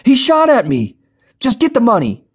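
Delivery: high, loud defensive yell; panicked shout. A narrator's reading. Darren yelling back at Jenny mid robbery, panicked shout. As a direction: yell back that he had no choice.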